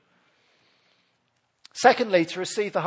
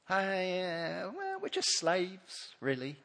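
quiet, hesitant and ashamed, barely voiced; sheepish mumble; 14 dB quieter, slower than usual. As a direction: mumble it like someone ashamed of who they are. Israelites voiced as shameful slaves.

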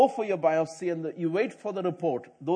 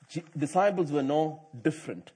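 ordinary talk, nothing marked

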